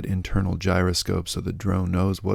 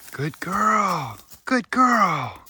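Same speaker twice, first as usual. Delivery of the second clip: high dog praise; 11 semitones higher, much slower than usual. Real, not acted. Praising the dog after her find, in squeaky baby talk. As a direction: gush over the good dog.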